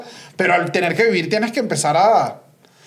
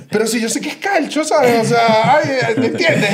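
high-pitched, louder retort; animated retelling of an indignant comeback; mock exasperated protest; 6 semitones higher, 3 dB louder, slower than usual. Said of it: "y yo y que".

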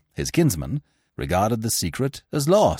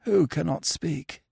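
hoarse, quieter voice from the doorway; hoarse demand; 7 semitones higher, 4 dB quieter than usual. A narrator's reading. Injured Smith surprising the room, hoarse and weak. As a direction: rasp it like a wounded man. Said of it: "demanded a hoarse voice".

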